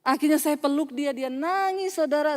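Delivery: ordinary delivery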